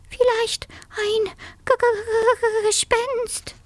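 very high, stuttering mouse squeak; trembling with fear. A narrator's reading. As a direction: stammer fearfully on the last word. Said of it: "zitterte bis in die Schwanzspitze".

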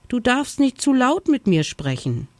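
high, nervous little hare voice; jumpy snow hare. A narrator's reading. Snow hare warns a bear he startles easily, jumpy. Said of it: "Ich bin sehr schreckhaft".

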